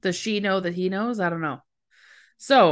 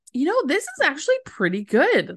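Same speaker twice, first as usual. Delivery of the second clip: higher-pitched, oblivious self-satisfaction; Angela; 5 semitones higher than usual. Retold or imitated. Host mimics a woman enjoying her tape at work, obliviously pleased. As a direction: play her pleased and clueless. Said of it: "she does not get the memo".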